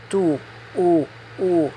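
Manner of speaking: tu and u with a pure back oo, no glide; u, tongue far back, steady oo; Spanish vowel. Shown as a demonstration. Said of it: "in English it's a diphthong".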